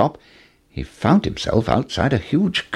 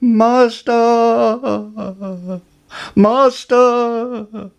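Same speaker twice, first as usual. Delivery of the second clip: very high, sustained alarm call; high, loud magic-harp wail; harp's cry; 13 semitones higher, 4 dB louder, much slower than usual. Acted. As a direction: sing out the warning on one long high note. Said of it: "the harp cried".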